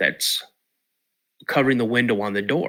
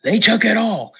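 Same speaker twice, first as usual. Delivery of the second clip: put-on much higher, urgent child voice; boy telling his dad the stuff is gone, high and urgent; excited kid; 10 semitones higher, 4 dB louder than usual. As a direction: play the frantic twelve-year-old. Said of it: "and so I'm like".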